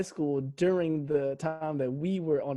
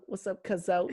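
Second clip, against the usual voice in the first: imitating cousins; 4 dB quieter than usual. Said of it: "my cousins still be saying".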